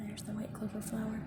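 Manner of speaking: soft unvoiced whisper. Real, not acted. Reader whispering to the children. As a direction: breathe it out gently.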